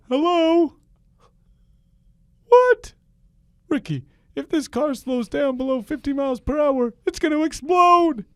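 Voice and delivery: very high, loud, alarmed bad impression; Jerry Seinfeld. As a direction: squeal the bad news as a hammed-up comedian. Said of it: "my Jerry Seinfeld is not great".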